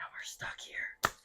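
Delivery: slow, hushed whisper; spooky whisper. Pretend. Host teasing aliens crashed on Earth, slow spooky whisper. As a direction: whisper it like an eerie secret. Spooky teaser for upcoming panel.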